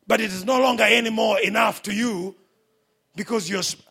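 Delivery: preacher raising his voice over the crowd; fiery preaching. Real, not acted. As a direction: drive it home to the congregation. Preaching at full force.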